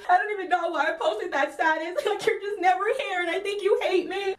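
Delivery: high, rapid whiny blubbering; mock crying. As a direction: imitate a roommate bawling out excuses. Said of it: "she just falls out crying".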